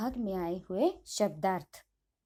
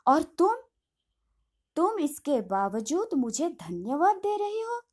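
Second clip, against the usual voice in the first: almost shout it at her in disbelief; near shout; 2 semitones higher than usual. Acted. Master almost shouting at the governess for thanking him. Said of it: "ऊंचे स्वर में लगभग चिल्लाते हुए कहते हैं".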